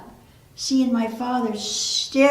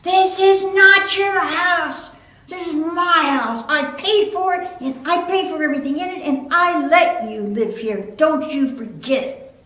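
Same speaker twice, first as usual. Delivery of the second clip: furious, high, loud yelling; loud outburst of an enraged father; exploding father; 5 semitones higher, 6 dB louder than usual. Retold or imitated. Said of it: "he just exploded like a volcano".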